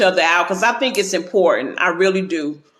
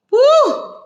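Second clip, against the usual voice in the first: a high whoop of pure joy; joyful whoop; 4 dB louder than usual. Real, not acted. Proud of herself after her first stream, high joyful whoop. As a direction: let out a high, loud whoop.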